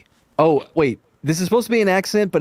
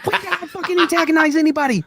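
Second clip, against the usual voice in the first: indignant; 12 semitones higher, faster than usual. Real, not acted. Protesting an accusation.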